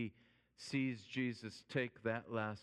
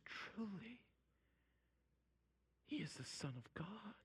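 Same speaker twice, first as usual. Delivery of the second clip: whispered confession of faith; hushed, reverent whisper; awestruck whisper; 9 semitones higher, 10 dB quieter than usual. Retold or imitated. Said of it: "he is struck with awe".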